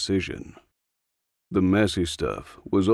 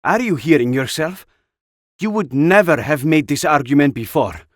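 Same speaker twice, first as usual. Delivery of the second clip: higher and louder, sharply disapproving; 7 semitones higher, 7 dB louder than usual. Acted. Anna rebuking Jack for his changed views, disapproving and loud. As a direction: scold him in open disbelief. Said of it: "Anna said disapprovingly".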